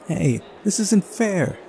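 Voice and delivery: high, slow whine of complaint; protesting girl character. Acted. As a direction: play a sore loser in a high voice. Voicing Uliana angrily protesting her loss.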